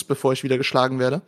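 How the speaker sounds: ordinary delivery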